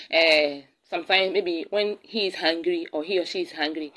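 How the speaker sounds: usual speaking voice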